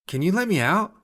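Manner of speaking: asking. A narrator's reading. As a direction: ask the machine hopefully. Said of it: "She asks the mech".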